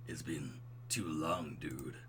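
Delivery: low, slow, soft dude voice; smooth and buttery. Acted. Old college friend greeting his buddy, smooth low bro voice. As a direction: drop it down, easy and unhurried. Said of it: "I can do smooth and buttery".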